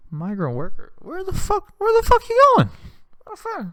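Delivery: high, squeaky disbelief; mock incredulous. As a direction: demand an answer in a shocked high voice. Retold incredulous reaction to worker.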